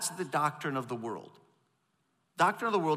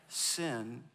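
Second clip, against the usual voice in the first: ordinary talk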